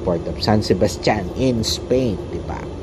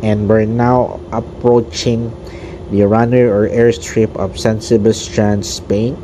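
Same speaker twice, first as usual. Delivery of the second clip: pilot announcement. Pretend. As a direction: speak like the captain over the intercom. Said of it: "I'll be speaking like a pilot of the plane".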